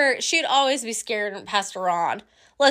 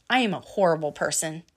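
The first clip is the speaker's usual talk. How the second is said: ordinary delivery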